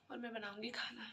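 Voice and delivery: usual speaking voice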